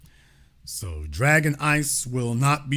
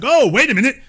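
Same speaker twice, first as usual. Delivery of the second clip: higher and louder mock double take; sudden realization; 8 semitones higher, 7 dB louder than usual. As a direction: jump up in pitch as if just catching on. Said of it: "it's like".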